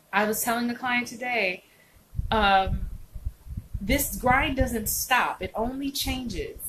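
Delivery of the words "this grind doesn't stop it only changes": fala lenta e enfática; each word spaced out and weighted; slow emphatic. Real, not acted. Drives home a point to viewers.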